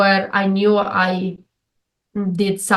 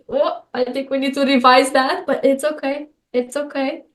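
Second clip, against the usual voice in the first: higher, soothing voice comforting herself after a mistake; kind self-talk; 5 semitones higher than usual. Retold or imitated. Woman soothing herself after messing up, higher and tender. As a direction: coo reassurance like to a child.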